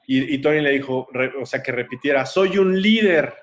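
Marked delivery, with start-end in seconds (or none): 2.3-3.3 higher, slow motivational declaration; forceful affirmation. Retold or imitated. Coach has the girl repeat it, forceful declaration. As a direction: proclaim it with LÍDER drawn out.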